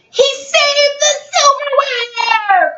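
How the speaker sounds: high, slow joyful shout; excited cry. Acted. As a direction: cry it out with delight. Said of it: "cried Mother".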